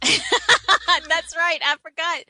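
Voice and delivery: laughing through the words, high and loud. Real, not acted. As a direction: burst out laughing as you agree. Laughing while agreeing about Hillary.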